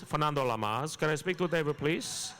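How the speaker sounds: put-on suave Latin-accented charm on the phone; Fernando Lamas impression. Prank phone call to the manager's office in a suave Latin accent.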